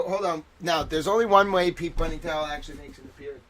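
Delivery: normal talking voice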